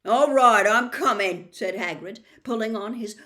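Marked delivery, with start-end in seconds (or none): 0.0-1.5 gruff. A narrator's reading. Hagrid gives in gruffly.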